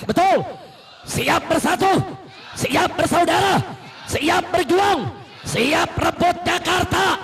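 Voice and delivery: high, loud call to the crowd; rally shout. Real, not acted. Preacher calling the crowd to takbir, shouting. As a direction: rouse them to shout back.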